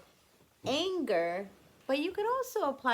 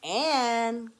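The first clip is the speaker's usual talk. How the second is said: louder, call her name across the house; 8 dB louder than usual. Acted. Grandfather calling Anne from the kitchen, louder call.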